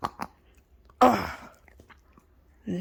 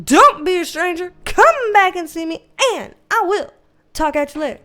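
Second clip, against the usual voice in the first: louder cheery sign-off; loud sign-off catchphrase; 5 semitones higher, 7 dB louder than usual. Pretend. Signing off to viewers, a louder cheery catchphrase. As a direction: belt out the goodbye line. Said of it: "[name], what do you tell 'em?".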